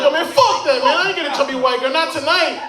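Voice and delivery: high-pitched indignant protest; adamant refusal. Retold or imitated. Man refusing his friend's plan, adamant and high-pitched. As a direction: refuse point-blank, voice shooting up.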